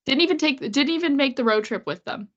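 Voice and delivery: ordinary talk, nothing marked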